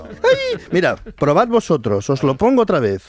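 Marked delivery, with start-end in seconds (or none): 0.0-0.6 very high, drawn-out coo; baby-talk coo. Shown as a demonstration. Host showing how one talks to babies, falsetto coo. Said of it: "cuando le hablas a un bebé".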